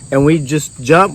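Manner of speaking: ordinary talk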